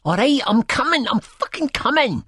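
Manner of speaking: very high-pitched screamed protest; shrieked, frantic reply; screaming. A narrator's reading. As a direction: screech it back in pain. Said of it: "she screams".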